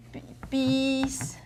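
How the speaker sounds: bees said softly and held long; drawn-out example word. Shown as a demonstration. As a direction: hold the vowel in bees. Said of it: "use words like".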